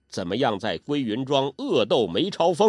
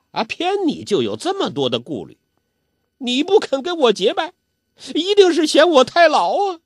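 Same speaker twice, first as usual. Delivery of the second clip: put-on high, whining old man working up to tears; a spoiled old man hurt that he's refused, voice climbing high and loud; sulky old urchin on the edge of a tantrum; Zhou Botong sulking; 13 semitones higher, 6 dB louder than usual. Petulant old man, about to cry.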